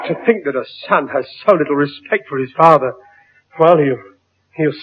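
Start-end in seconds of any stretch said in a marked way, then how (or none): none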